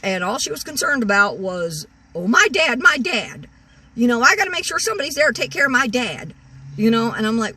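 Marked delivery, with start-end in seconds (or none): 2.1-6.3 higher, louder sing-song mock of her fretting; mocking whiny quote. Mocking the sister fretting about her dad, higher and louder. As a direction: parody her fussing over her dad.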